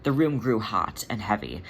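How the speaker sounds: plain reading voice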